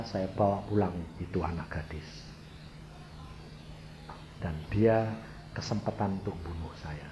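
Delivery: low, soft, measured telling; calm explanation. Retold or imitated. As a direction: lay out the story quietly, with pauses. Calmly explains himself to would-be killers. (penjelasan tenang dan pelan)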